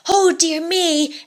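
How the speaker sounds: put-on boy exclaiming as he watches, delighted; delighted exclamation. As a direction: exclaim it with glee. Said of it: "exclaimed Percy".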